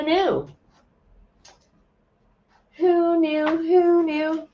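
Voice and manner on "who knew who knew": much higher, teasing sing-song; playful sing-song. Pretend. To livestream viewers after finding her calendar, playful high sing-song. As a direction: toss it up high like a little tune.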